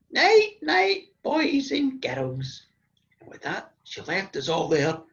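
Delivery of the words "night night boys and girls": high sing-out, slowing at the end; granny's cheery goodnight. Retold or imitated. Granny leaves terrified children in bed, high singsong goodnight. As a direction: chirp it high, then draw it out. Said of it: "my granny looked at us and said".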